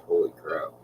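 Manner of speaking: ordinary delivery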